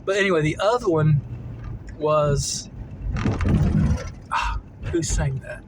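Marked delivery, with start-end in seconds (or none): none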